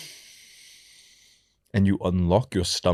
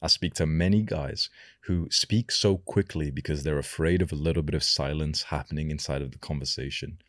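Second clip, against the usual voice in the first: usual speaking voice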